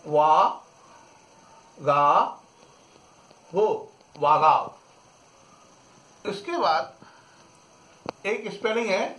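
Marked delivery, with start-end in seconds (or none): 0.0-4.7 वा, गा, वो spelled out slowly, then वागाव for "brave"; French "brave" as वागाव; French pronunciation. Shown as a demonstration. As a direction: build वागाव sound by sound, slowly. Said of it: "तो इसको अपन पढ़ेंगे".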